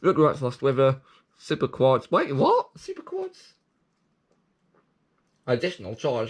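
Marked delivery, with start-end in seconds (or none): 2.1-2.7 baffled. Real, not acted. Confused by ride name. 2.8-3.5 high, disbelieving question; incredulous. Real, not acted. Incredulous repeat of name.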